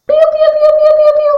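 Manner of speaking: high, loud little chick peeps; chick peeping. Shown as a demonstration. Teacher showing children the chick's sound, high peeping. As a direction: peep like a tiny chick. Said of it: "Ahora vamos a hacer los sonidos de estos animales".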